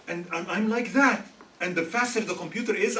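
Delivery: usual speaking voice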